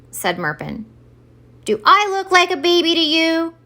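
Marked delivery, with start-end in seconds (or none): 1.6-3.5 big, loud, raised boom. A narrator's reading. Santa demanding an answer from the elf Merpin, booming. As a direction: throw the question out like a bellowing Santa. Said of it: "boomed Santa".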